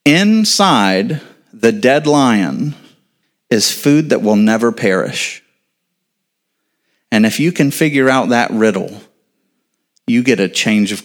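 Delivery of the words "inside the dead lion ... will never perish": drawn out low and slow; slow, weighty. Shown as a demonstration. Preacher to congregation, slow hushed reveal. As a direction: let each word land like a reveal.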